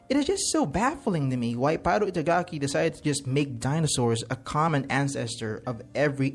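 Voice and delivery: usual speaking voice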